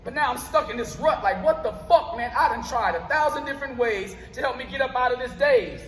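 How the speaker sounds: rhythmic, punchy spoken word delivery, words hit on the beat; spoken word poem. Acted. Poet performing his piece for a live crowd, rhythmic spoken word. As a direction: perform it like a slam poet on stage.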